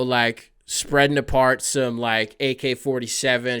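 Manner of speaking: usual speaking voice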